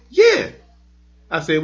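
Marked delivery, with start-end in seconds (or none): 0.1-0.5 put-on high squeaky little kid yes. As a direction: pipe up like an excited small boy. Dad voicing his young son.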